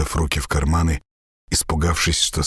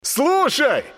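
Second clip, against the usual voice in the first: calling out. A narrator's reading. Sasha calling out.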